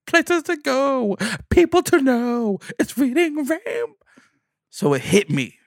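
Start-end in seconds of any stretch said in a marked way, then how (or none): 0.1-3.9 very high, slow, sung-out host voice; a hammed-up kids' show host; LeVar Burton. LeVar Burton encouraging him, very high and slow. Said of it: "LeVar Burton told me".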